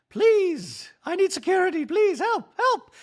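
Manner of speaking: high panicky droid plea; Dorit robot voice. Retold or imitated. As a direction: beg like a frantic droid. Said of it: "I do that voice for Dorit".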